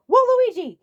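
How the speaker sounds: loud high call of his name; stern call. Acted. Toadsworth calling out Waluigi, loud and high. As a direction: call him out sharply.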